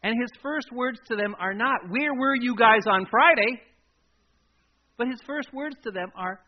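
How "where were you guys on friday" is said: loud, higher accusing question; mock reproach. Jesus hypothetically scolding his absent disciples, loud mock reproach. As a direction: demand it in raised-voice mock indignation.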